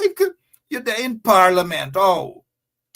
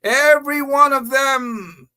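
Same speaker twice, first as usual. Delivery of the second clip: exasperated shout; 8 semitones higher, 3 dB louder than usual. Real, not acted. Host ranting about parliamentarians.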